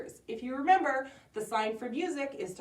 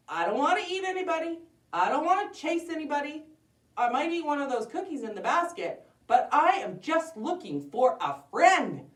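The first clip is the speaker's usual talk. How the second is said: higher, earnest, plaintive wolf; pleading wolf; 8 semitones higher, 6 dB louder than usual. Acted. Wolf explains he wants a friend, earnest pleading. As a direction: plead your innocence in a raised voice.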